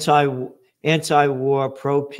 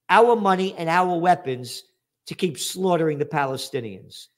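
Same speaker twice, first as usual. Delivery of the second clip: usual speaking voice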